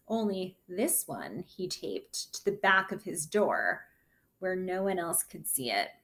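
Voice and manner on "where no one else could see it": ordinary delivery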